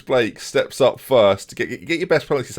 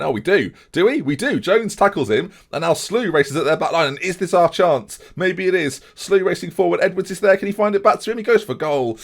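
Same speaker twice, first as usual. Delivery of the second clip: high-pitched commentary on a breaking attack; raised pitch, urgent play-by-play; excited commentary; 7 semitones higher than usual. Real, not acted. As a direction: call the chance as it builds. Live match commentary, chance building.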